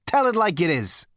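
high, cheering encouragement; merry heckler's approval; jovial egging-on. A narrator's reading. Zaphod merrily toasts the ruler.

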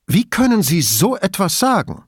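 high-pitched, affronted challenge; sich Mut machend. A narrator's reading. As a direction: protest to bolster your courage. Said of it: "um sich Mut zu machen". (mit hoher Stimme empört)

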